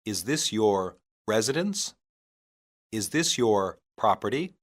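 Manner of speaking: ordinary delivery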